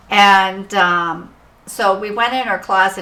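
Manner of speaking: ordinary talk, nothing marked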